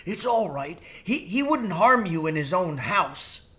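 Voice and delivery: high crow voice; reassuring bird; lightly voiced crow. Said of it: "whisper very softly".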